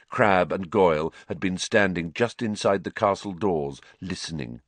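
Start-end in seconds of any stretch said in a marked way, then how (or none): none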